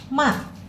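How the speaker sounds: ma falling with a jolt; punched fourth tone ma. Shown as a demonstration. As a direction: stamp ma down. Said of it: "Agak dihentak ya".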